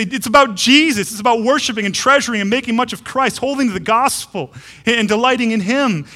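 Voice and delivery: joyful rising declaration of faith; fervent proclamation. Acted. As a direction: proclaim it like a preacher at full force. Voicing what the blessings say.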